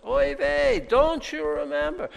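oy vey, high Yiddish lilt; Yiddish-inflected exasperated plea; Yiddish accent. Acted. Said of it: "oy veyness about this".

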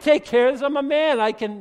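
loud, high, cocksure claim; loud boast. Acted. Boastful man cut down by God.

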